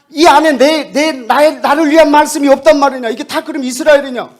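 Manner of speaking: very loud, high, outraged yell; furious shout. Retold or imitated. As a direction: blast it out in indignant rage. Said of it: "막 이 사람이 막 화가 나는 거야". (분노에 차서 외치듯)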